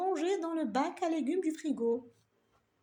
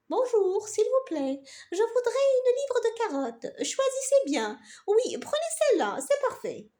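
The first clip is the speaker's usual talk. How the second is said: polite lady customer; 8 semitones higher, 4 dB louder than usual. Acted.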